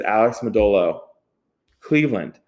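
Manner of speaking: "Alex Modolo", a long beat, then "Cleveland" alone and falling; pause before Cleveland. Retold or imitated. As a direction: hold back "Cleveland" for a moment after "Alex Modolo". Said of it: "he had paused just enough to say Cleveland".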